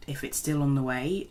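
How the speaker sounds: normal talking voice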